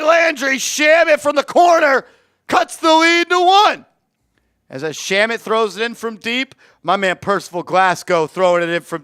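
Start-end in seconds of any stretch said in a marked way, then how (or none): 0.0-3.9 energetic sportscaster call; play-by-play call. Acted. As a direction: call the game live like a broadcaster. Live basketball play-by-play.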